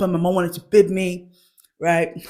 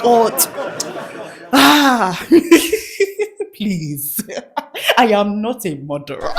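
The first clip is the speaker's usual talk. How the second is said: laughing mock prayer; slower than usual.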